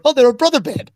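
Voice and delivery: mocking imitation. Host throwing cohost's words back, mockingly imitating. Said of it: "and you're going".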